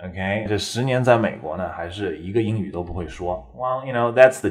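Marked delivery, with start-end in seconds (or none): none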